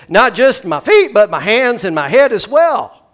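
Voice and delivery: high, loud overeager plea; overeager Peter. Retold or imitated. Peter begging Jesus, high and loud. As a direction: go all in at once. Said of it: "he says".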